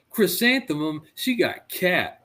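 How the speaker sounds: put-on outraged kid. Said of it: "and he goes".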